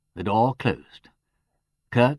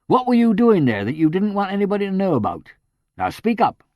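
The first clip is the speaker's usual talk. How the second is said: higher, louder menacing demand; dangerous note; 11 semitones higher, 7 dB louder than usual. A narrator's reading. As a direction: press him with a threat in the voice. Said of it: "with a dangerous note in his voice".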